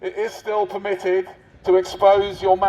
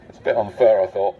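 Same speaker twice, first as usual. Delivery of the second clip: normal talking voice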